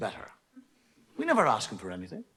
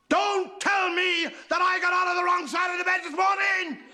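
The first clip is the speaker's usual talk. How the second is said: high, loud exasperated shout; hammed-up livid outburst breaking into laughter; 3 dB louder than usual. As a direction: explode with fed-up fury. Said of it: "by then she's livid".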